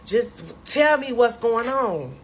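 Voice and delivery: high, loud pleading outburst; distraught demand. Retold or imitated. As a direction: demand answers, worked up but not sobbing. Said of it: "I just start crying even more".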